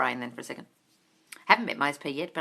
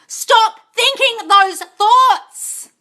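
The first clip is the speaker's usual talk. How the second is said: louder, very slow Stop thinking those thoughts; each word pressed out like an order; slow firm command; 7 semitones higher, 8 dB louder, much slower than usual. Real, not acted. Scolding her followers, slow and loud.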